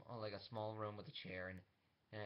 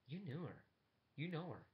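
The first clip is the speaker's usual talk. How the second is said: higher, acted disbelief; raised pitch, taking in startling news; stunned realization; 7 semitones higher than usual. Yeldan realizes Steven knew the rebel, stunned realization.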